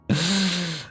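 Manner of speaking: breathy whispered punchline; whispered aside. Pretend. Mocking Denver to the chat, in a whisper. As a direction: drop to a whisper for the team name.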